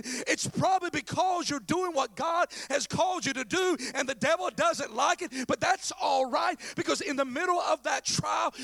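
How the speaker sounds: loud, driving sermon delivery; full-force pulpit preaching; impassioned preaching. Real, not acted. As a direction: preach it hard, punching the key words.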